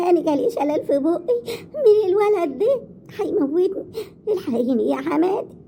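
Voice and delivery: high, tearful plea for help; wailing complaint. Acted. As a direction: wail it out as a desperate appeal. Daughter-in-law wails to mother-in-law for help.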